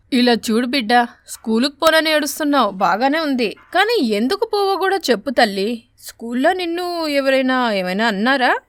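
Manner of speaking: put-on a crow mother asking her crying child why; coaxing crow mother. Crow mother coaxes daughter. (ఏడుస్తున్న కూతుర్ని అడిగే కాకి తల్లి గొంతు)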